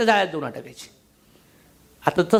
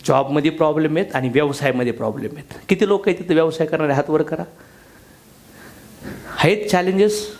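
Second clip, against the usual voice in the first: ordinary talk, nothing marked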